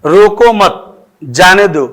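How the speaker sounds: slow "रोको मत" as one group, break, "जाने दो"; pause after मत. Shown as a demonstration. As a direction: stretch रोको मत, stop, then say जाने दो. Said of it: "अगर ये कॉमा यहाँ लग जाता है".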